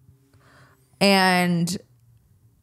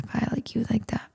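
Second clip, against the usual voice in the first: whispered; 4 semitones higher, 9 dB quieter, faster than usual. Retold or imitated. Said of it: "I said".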